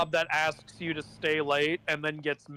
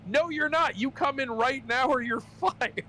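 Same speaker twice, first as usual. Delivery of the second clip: higher, half-laughing mock threat from the manager; angry manager; 8 semitones higher than usual. Manager threatening to fire Steve, mock angry. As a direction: play the bossy manager firing him, laughing through it. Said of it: "she's, like, mad".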